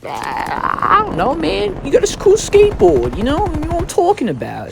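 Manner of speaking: squeaky teasing imitation of the thief; a bratty kid shrugging off the theft, mocked high; mocking kid voice.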